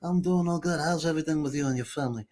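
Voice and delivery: a hint of a Sicilian godfather; father's Godfather voice. Son imitating his Sicilian father, lightly Brando-like. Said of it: "My father would go".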